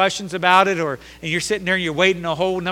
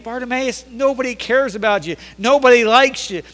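hint of scornful onlookers, pitched higher; jeering crowd; 5 semitones higher, 6 dB louder than usual. Everybody telling Bartimaeus he is a burden, scornful. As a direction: put him down in a raised preacher's voice. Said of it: "everybody's saying".

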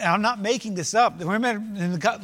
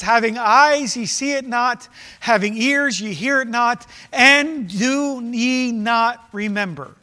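slow, weighty King James reading; slow scripture reading; 4 dB louder, slower than usual. Acted. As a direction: draw each line out solemnly. Reads scripture slowly.

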